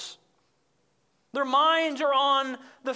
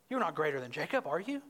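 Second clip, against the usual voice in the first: mocking; 5 semitones lower, 6 dB quieter, faster than usual. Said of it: "So that's her mocking answer".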